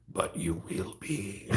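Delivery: soft, slow, creepy threat; Yoda. Retold or imitated. Teasing viewers as crazy Yoda, creepy. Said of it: "that crazy Yoda".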